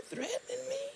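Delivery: very high, incredulous question; can't believe he's threatening her; high-pitched outraged disbelief; shocked challenge. Retold or imitated. Caller confronts threatening pharmacist.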